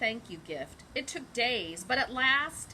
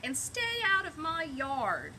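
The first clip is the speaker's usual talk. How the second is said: mild mock yell; 5 semitones higher than usual. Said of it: "Hank yelled back".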